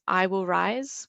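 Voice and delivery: ordinary delivery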